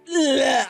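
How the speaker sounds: loud, overwhelmed "ugh"; frustrated groan. Retold or imitated. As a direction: let out an exasperated groan. Overwhelmed while retelling her rant.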